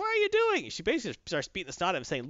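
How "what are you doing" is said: much higher, startled troll voice; mock high troll alarm; hippie troll. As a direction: squeak it out like a shocked gentle troll. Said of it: "He's like".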